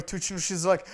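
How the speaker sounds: ordinary talk, nothing marked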